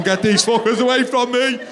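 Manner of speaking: high, loud comic yelling; hammed-up harassed rant, pitched right up; exasperated gorilla. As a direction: shout them off like a pestered gorilla. Voices gorilla overrun by toddlers.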